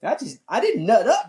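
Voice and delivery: ordinary delivery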